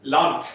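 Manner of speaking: "Land" with the final D struck as T; percussive final T. Shown as a demonstration. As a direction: say "Land" as if spelt with a popped T. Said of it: "use that percussive T".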